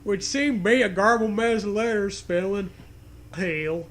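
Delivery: usual speaking voice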